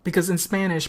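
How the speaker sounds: normal talking voice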